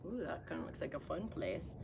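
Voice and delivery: ordinary delivery, nothing marked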